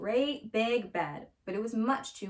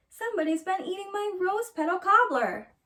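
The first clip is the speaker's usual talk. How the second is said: higher mama bear, a touch louder; mama bear complaining, pitched up; middle-sized bear voice; 10 semitones higher, 4 dB louder than usual. Acted. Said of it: "in her middle-sized bear voice".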